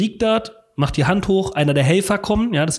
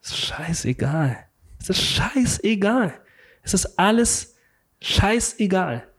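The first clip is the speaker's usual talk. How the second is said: slow, drawn-out words, rising higher on the repeats; blissful indifference; 4 semitones higher, slower than usual. Retold or imitated. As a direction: savour not caring at all. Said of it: "In diesem Gefühl".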